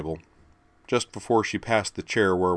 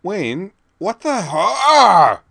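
high, loud startled yelp into a crash; Jerry stumbling in the doorway, yelping in surprise; startled cry; 13 semitones higher, 12 dB louder, much slower than usual. Acted.

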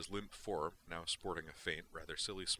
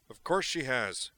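louder, slow, a little combative; a trifle belligerent; 7 semitones higher, 11 dB louder than usual. Acted. Duke answers McCoy a trifle belligerently. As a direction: lean into it with mild defiance. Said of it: "a trifle belligerently".

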